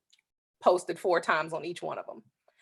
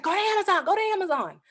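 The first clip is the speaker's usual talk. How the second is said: retold excited friend, pitched much higher; excited urging; 12 semitones higher, faster than usual. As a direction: hurry her to the computer.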